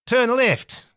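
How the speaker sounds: amateur Australian impersonation; attempted Australian accent. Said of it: "I can't do an Australian accent".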